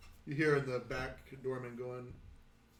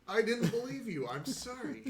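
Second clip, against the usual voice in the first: high character voice breaking into laughter; playful, squeaky-high excuse; high-pitched sheepish apology; 10 semitones higher, 3 dB louder than usual. Acted. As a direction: plead it high, half laughing. She apologises with a shrug, laughing.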